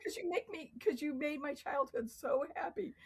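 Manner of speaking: high, fast starstruck gushing; breathless fan pleading for a kiss on the cheek. Retold or imitated. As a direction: gush like a starstruck fan meeting her idol. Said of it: "I was like".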